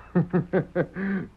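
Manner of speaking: sly, satisfied villain laugh; villain's chuckle. Acted. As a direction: chuckle like the scheme just fell into place. Villain hears plan fits.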